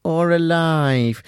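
slow, dramatic game show reveal; drawn-out game show tease. Shown as a demonstration. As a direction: stretch it out like a quiz host. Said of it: "can you tell me whether they are dead".

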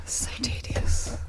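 slow weary whisper. Real, not acted. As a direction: breathe it out, bored.

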